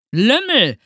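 loud, high indignant outburst; scolding. A narrator's reading. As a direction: scold like an outraged teacher. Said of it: "schimpfte Herr Groll". (laut schimpfend)

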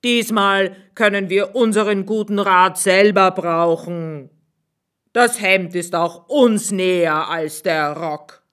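a canny landlord keeping his counsel; innkeeper's voice. Acted.

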